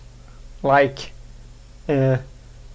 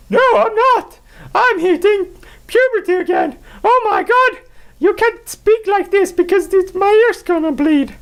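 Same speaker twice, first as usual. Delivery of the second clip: squeaky voice. Pretend. Answering viewers in a very high puberty squeak. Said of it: "People are gonna be like".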